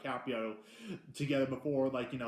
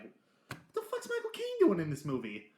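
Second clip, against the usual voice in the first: shocked disbelief; 7 semitones higher, 5 dB louder than usual. Retold or imitated. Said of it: "it's like".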